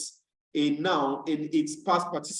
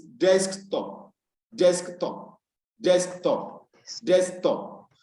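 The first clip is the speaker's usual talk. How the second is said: "desktop" with DESK punched, top falling away; stress on DESK; much slower than usual. Shown as a demonstration. Teacher drilling compound nouns for learners, stress on DESK in "desktop". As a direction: hit the first syllable of "desktop". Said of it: "has its stress on the first syllable".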